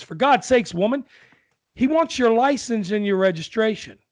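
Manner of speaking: put-on high, loud fed-up old man; exasperated old husband. Old husband to deaf wife, loud exasperated. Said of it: "With accents and all".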